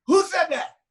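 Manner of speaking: imitating an offended star; indignant challenge. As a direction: call out the heckler, mildly mock-angry. [name] challenges an unseen heckler.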